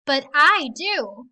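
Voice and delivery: slow, loud, gloating bully; slow mean taunt. Acted. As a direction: draw it out to rub it in. Big Red mocking Bitty.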